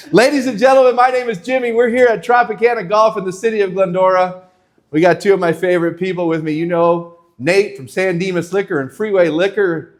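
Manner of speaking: loud, high announcer voice; high, loud promo read with plugs; host intro. Acted. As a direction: open the show like an eager host. Show host opening.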